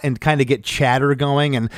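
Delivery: usual speaking voice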